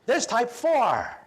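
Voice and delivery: put-on pesky little boy. Boy tugging at the professor's pants, insisting in a high childish voice. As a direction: play a ten-year-old pestering the professor. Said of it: "little boy ... he says".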